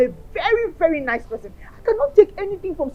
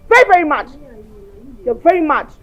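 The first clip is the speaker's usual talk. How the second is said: loud, hammering accusation; angry scolding; 7 dB louder than usual. Acted. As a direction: tell her off hard, stressing very. Scolding friend as mad.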